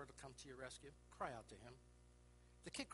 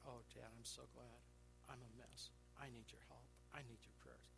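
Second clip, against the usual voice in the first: quiet, low, pleading confession; a touch of tearful relief; soft tender plea; 5 semitones lower, 12 dB quieter than usual. Retold or imitated. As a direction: hush it like a boy opening up to dad. Boy confides in his father.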